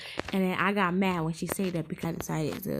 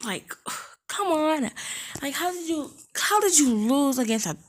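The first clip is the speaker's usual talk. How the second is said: exasperated; 8 semitones higher than usual. Real, not acted. Exasperated at older girl.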